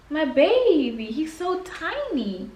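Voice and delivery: high, slow cooing at the puppy. Real, not acted. Owner cooing to her little dog, high slow baby talk. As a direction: coo over the tiny dog. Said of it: "cooing at her dog".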